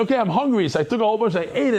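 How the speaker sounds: breathless eager storytelling, tipping into a shout; excited retelling. Retold or imitated. Hungry guest retells grabbing food.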